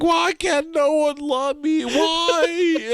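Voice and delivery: high-pitched, wailing imitation of a crying woman; hammed-up heartbroken wail; mock crying. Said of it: "She just cries like".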